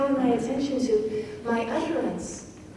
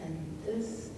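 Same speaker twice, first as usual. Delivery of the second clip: slowing to standstill; 6 dB quieter, much slower than usual. Shown as a demonstration. Teacher showing time slowing in the last two lines, hushed and halting. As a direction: let each word halt, sinking into near silence. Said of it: "You're being slowed down to the moment".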